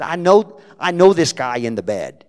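near-shouted, high New Jersey insistence; booming New Jersey accent at the bedside; loud Jersey accent. Retold or imitated. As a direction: belt it out like a blunt Jersey woman. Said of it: "in her broken Jersey accent".

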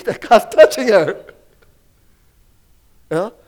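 ordinary delivery

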